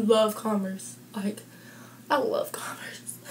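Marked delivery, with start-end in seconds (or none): 2.1-3.2 soft breathy whisper. Real, not acted. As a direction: whisper it like a secret. Whispered aside.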